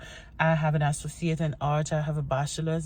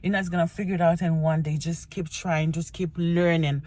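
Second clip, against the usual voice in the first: ordinary delivery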